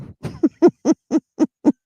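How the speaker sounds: high burst of laughter. Real, not acted. Lecturer laughing at her own joke, high real laugh. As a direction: crack up at your own joke.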